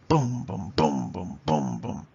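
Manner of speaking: each first "boom" hit hard, the second dropped; heavy-light drumbeat on "boom, boom"; aggressive trochees. Shown as a demonstration. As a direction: pound out BOOM-boom pairs. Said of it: "trochees are more aggressive".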